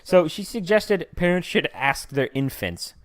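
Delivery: usual speaking voice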